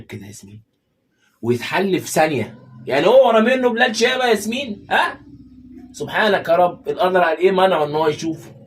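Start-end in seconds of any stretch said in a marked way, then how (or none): 1.4-8.6 much higher and louder outraged outburst; outraged disbelief. Acted. Husband to wife over the soap's twist, loud outraged disbelief. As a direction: explode at the plot twist.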